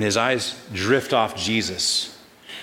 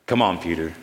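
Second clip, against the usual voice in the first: lower, easy-going Jesus inviting Peter; a lower, fond call to step out; gentle Jesus; 7 semitones lower than usual. Acted. Jesus invites Peter out of the boat, lower and fond.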